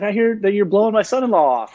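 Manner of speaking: Terry scolding the buyer, high mock-stern voice; Terry Bradshaw voice. Retold phone call, Terry voiced.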